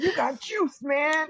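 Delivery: high-pitched gotcha yell; mock taunting shout. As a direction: call it out high, taunting the victim. Acting out prankster taunting victim.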